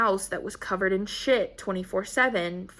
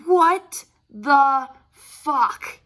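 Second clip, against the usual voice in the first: slow, drawn-out, louder shock; stunned disbelief; 4 semitones higher, 7 dB louder, much slower than usual. Retold or imitated. Staring at two months of poop, stunned and slow. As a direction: stretch every word in horror.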